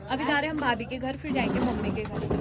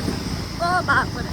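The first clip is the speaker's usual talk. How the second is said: drawn-out slow gasp of dismay; oh my god; 2 semitones higher, 5 dB louder, much slower than usual. Real, not acted. Vlogger sees the broken road, slow dismayed exclaim. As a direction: stretch it out, aghast at the road.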